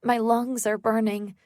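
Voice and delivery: usual speaking voice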